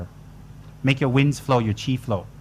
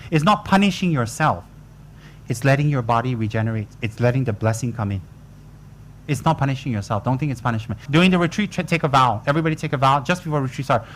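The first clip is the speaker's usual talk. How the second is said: normal talking voice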